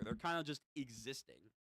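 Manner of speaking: ordinary delivery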